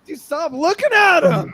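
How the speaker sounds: loud, high-pitched protest; indignant yell. Real, not acted. Telling a friend off for staring at the feet, indignant yell.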